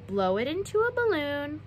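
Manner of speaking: ordinary delivery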